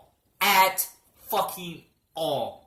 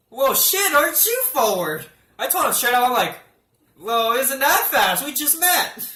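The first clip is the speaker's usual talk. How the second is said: higher, louder mock outrage; mock scandalized; 7 semitones higher, 6 dB louder than usual. Teasing the doctor about moving fast, mock indignant. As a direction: play it as a scandalized flirt.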